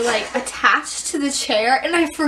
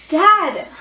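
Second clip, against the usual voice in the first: calling out; 2 semitones higher, 5 dB louder than usual. Acted. Skit, calling to dad.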